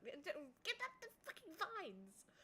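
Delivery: high, slow frustrated yell; raging gamer. Real, not acted. Struggling in the game.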